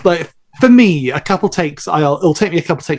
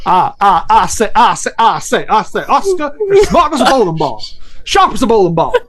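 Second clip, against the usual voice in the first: high, blustery put-on cartoon rooster, laughing; 6 semitones higher than usual. A high cartoon rooster voice, mocking the character Oscar. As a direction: puff up like a blowhard cartoon rooster.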